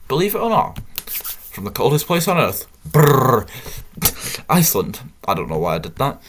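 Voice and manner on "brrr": loud trilled brrr; exaggerated shiver noise on rolled lips; a big, loud comic brrr; mock shiver. Shivers at coldest place.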